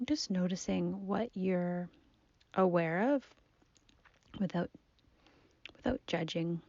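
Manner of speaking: soft, unhurried meditation voice with long pauses; guided body scan. Acted. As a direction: gently guide the listener's attention through the body. Leads a seated body scan.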